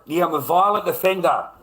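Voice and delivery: loud, high mock confession; sarcastic. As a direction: throw the label back at them with scorn. Sarcastic self-mockery about a label.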